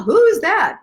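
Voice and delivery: high, loud cartoon gasp of a question; hammed-up who's that; mock dramatic question. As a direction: ask like a startled bystander in a comic. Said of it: "shtick for that one".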